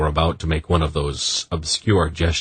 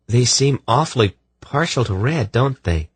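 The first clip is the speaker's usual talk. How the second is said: put-on higher, curious young woman's voice; a hint of a wondering girl; lightly voiced young woman; 7 semitones higher than usual. A narrator's reading. Ariande remarks on red carpets.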